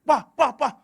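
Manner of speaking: loud, high, urgent calling; anak memanas-manasi. Retold or imitated. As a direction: imitate a boy urgently egging on his elder. Anak mendesak bapak agar berkelahi. (meniru anak memanggil mendesak)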